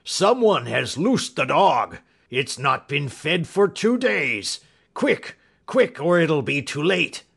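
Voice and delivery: loud, high alarm in a character voice; panicked cry. Acted. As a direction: shout the warning, drawn out then urgent. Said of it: "he cried".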